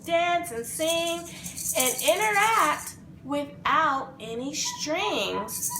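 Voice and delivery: plain reading voice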